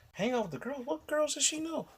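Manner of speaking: outraged double take at her excuse; disbelief. Real, not acted. To listeners about the girlfriend's girls night, high incredulous.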